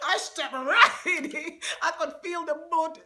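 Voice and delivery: laughing. Real, not acted. Steps in mud, laughing.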